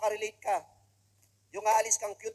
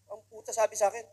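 ordinary delivery

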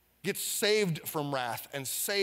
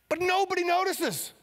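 high exclaimed emphasis; 13 semitones higher, 3 dB louder than usual. Acted. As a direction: leap way up high on nobody notices. Preacher stresses that nobody noticed.

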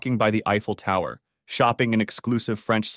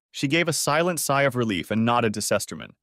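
usual speaking voice

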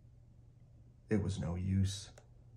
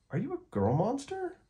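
put-on much higher, astonished kid; surprised child. A narrator's reading. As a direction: gasp it out, amazed.

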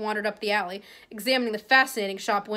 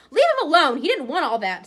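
much higher and louder protest; Ginny defends; 5 semitones higher, 6 dB louder than usual. Acted. Young girl defends Harry against the bully, a loud high protest. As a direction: stand up for him sharply. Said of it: "said Ginny".

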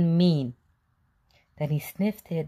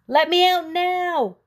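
high, loud, tiny cookie voice crying out; odd little voice crying; 13 semitones higher, 7 dB louder, slower than usual. A narrator's reading. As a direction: cry out from inside the oven, tiny and shrill with panic. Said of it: "cried an odd little voice".

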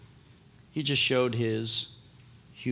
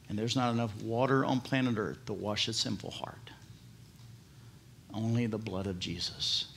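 whisper; 4 semitones lower, 8 dB quieter than usual. Real, not acted. Solemn hushed sermon point.

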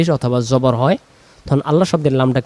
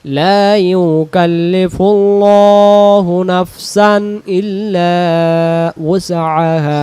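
slow, louder chanted recitation; Quran recitation; 3 semitones higher, 4 dB louder, much slower than usual. Shown as a demonstration. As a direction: recite with long held vowels. Teacher recites the verse.

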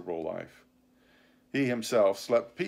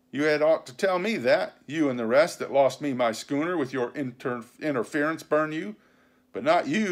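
higher-pitched, scornful tongue-lashing; angry pirate captain Silver; 5 semitones higher, 4 dB louder than usual. Acted. Silver scolding George for losing the schooner, angry. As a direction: berate a useless underling with punchy emphasis.

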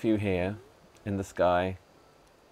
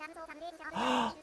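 gasp; 9 dB quieter than usual. Real, not acted. Gasp at the gift.

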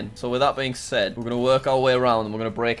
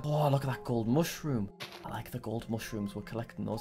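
soft coo; 4 semitones lower, 10 dB quieter than usual. Real, not acted. Cooing over mushrooms.

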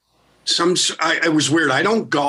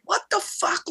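stunned disbelief; 6 dB quieter than usual. Real, not acted. Stunned by neighbors' gifts.

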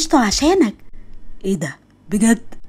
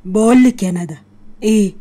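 ordinary delivery

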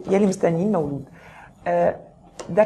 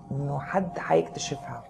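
secretive whisper; 4 semitones lower, 8 dB quieter than usual. Acted. Revealing a secret relationship.

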